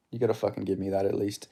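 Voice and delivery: ordinary delivery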